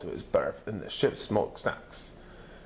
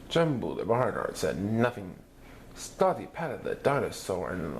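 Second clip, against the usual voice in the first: usual speaking voice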